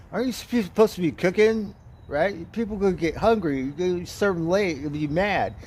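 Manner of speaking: a sushi chef with knives nagging the teller; mock scolding sushi chef.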